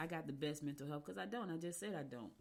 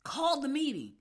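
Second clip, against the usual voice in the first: louder, higher, firm order; insistent retold demand, raised voice; mock insistent demand; 8 semitones higher, 9 dB louder than usual. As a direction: bark the order as she retells it.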